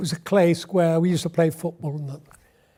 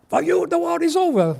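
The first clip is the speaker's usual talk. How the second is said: much higher, hammy enemy officer line; mock movie officer announcing capture, pitched way up; old war film officer; 10 semitones higher than usual. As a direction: deliver it like a clichéd war-movie captor. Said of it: "just like the o-, old war films".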